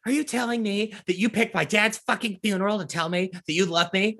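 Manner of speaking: high, indignant mock rant; mock outrage. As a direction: rant at him, offended. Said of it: "Do it".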